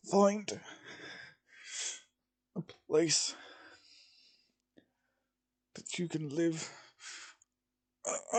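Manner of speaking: weak, halting dying man; fading last words, stammering. Acted. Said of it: "gonna have to try and do a dying voice here".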